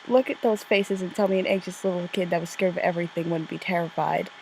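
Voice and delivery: ordinary talk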